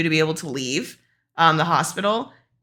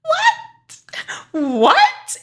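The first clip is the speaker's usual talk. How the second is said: squeaky high disbelieving what; retelling her shock at the surgery risk, high-pitched disbelief; 3 dB louder than usual. Retold or imitated. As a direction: gasp it out in sheer disbelief. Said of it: "And I'm like".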